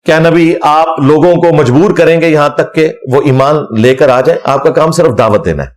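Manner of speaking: usual speaking voice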